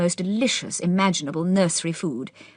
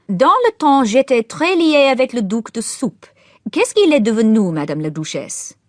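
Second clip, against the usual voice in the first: lecturer's French chat; 6 semitones higher, 7 dB louder than usual. A narrator's reading. Lecturer chatting up the Duchess, high and loud.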